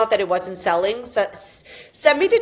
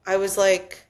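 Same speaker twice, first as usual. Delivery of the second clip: ordinary delivery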